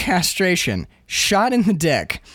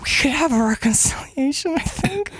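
hushed Mary; 5 semitones higher than usual. Acted. Mary voiced at divorce hearing.

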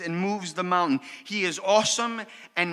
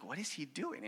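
amused aside; 4 semitones lower, 14 dB quieter than usual. Retold or imitated. Wife's amused aside to husband.

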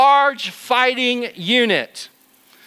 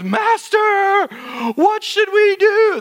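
panicked servant; 13 semitones higher, much slower than usual. Acted. Servant panics at surrounding army.

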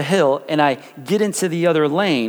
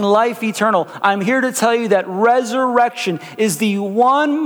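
higher, rising preaching voice; 5 semitones higher than usual. Acted. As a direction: build the message with fervor. Pastor preaching the resurrection.

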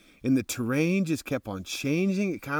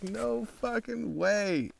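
awed disbelief; 8 semitones higher, 5 dB quieter, much slower than usual. Retold or imitated.

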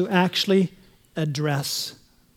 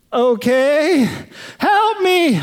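desperate plea; 5 semitones higher, much slower than usual. Acted. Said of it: "man clinging to branch".

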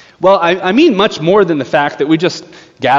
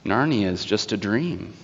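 put-on soft, low, spellbound children; enchanted repeating; 5 semitones lower, 10 dB quieter than usual. Enchanted children repeating after the witch, hushed and low. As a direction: echo it quietly, as if entranced. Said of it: "the children repeat after her".

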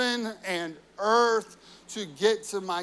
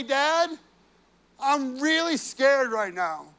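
pleading, scared son's voice, high and loud; a frightened son begging his father for help; desperate cries of Dad, raised and slowed; Jesus crying out; 5 semitones higher, 4 dB louder than usual. Acted. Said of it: "He's crying out".

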